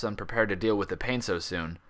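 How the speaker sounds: ordinary delivery, nothing marked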